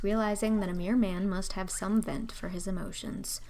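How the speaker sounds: ordinary delivery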